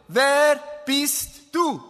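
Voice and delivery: slow, drawn-out officer's question; stern interrogator. Retold or imitated. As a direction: let each word hang like a commissioner. Police commissioner interrogates him. (langsam, drohend fragender Kommissar)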